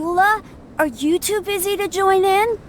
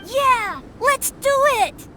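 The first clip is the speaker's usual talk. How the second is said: put-on high, excited kid's cheer; thrilled child accepting a race; eager shout; 9 semitones higher than usual. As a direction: jump at the dare.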